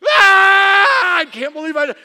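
loud, high acted scream into disbelief; full-voiced retold shriek. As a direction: scream out in shock at yourself. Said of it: "I screamed".

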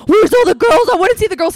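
put-on high, loud drunken-guy clamor; going crazy. Drunk guy in the trunk, crazed excited yelling. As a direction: imitate a wild, girl-crazy holler. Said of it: "he's, like, going crazy for girls".

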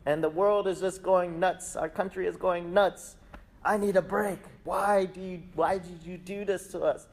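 high-pitched, fed-up mock rant; exasperated. Social media user pleads with God, mock exasperated. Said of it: "Go on there and like".

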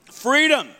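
FREEDOM called out loud and high. Shown as a demonstration. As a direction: lead the church shouting Freedom. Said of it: "Say freedom, church".

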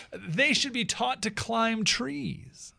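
confident monkey voice, drawn out; positive monkey. Acted. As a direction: state it as settled fact, slowly. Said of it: "said the monkey, positively".

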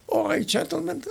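a half-attempt at the maestro dismissing the orchestra; Stravinsky. Narrator quoting Stravinsky ending rehearsal, hint of his voice. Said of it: "I can't do his accent".

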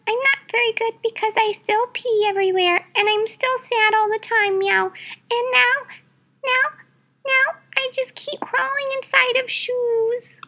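high squeaky little cat character; high-pitched cartoon kitty; cat caller voice. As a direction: play a small cat calling in. Fluffy complains sadly.

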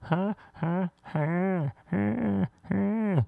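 small high "ha"s, held back; muffled mock orgasm. Woman alone in the bathroom at night, muffled high yelps. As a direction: imitate stifled climax yelps through a wall. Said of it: "It's muffled through the wall".

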